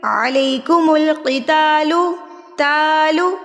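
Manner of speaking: علیکم with a short ya, then القتال with a heavy qaf and the alif drawn out; correct tajweed. Shown as a demonstration. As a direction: recite علیکم القتال slowly with a full qaf. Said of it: "تو کیسے پڑھیں گے؟". (علیکم القتال کو موٹے قاف اور کھینچ کر پڑھیں)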